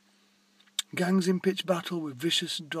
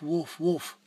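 little dog woof; 4 dB louder than usual. Acted. Reading to listeners, small dog woofs at the snakes. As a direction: gently imitate a small dog's bark. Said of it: "and then a little woof".

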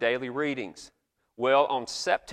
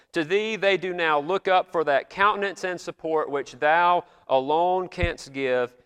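put-on solemn old prayer; 3 semitones higher, 4 dB louder than usual. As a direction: intone it like an eighteenth-century pastor at prayer. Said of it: "I wish I could go around talking like this today".

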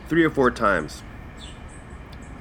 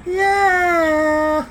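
squeaky yeah; 3 dB louder than usual. Acted. Silly squeaky exclamation after swear.

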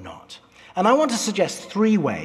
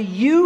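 "you" punched higher and louder; emphatic you; 7 semitones higher, 5 dB louder than usual. Shown as a demonstration. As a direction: hit "you" hard, it means all of you. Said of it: "here is emphatic and it's plural".

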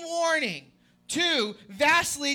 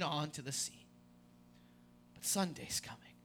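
hushed sermon; 9 semitones lower, 6 dB quieter than usual. Acted. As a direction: draw them into the silence of the tomb. Hushed buildup toward Sunday.

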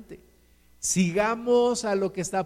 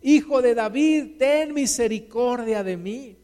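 crying out; 2 semitones higher, 4 dB louder than usual. Acted. Blind beggar calling out to Jesus, crying out. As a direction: call out to be heard over a crowd. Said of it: "comenzó a dar voces".

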